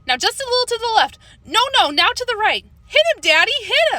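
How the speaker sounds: high, urgent calls to hit the spider; excited calling out. A narrator's reading. Girl directs father swatting spider, high excited calls. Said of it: "I called".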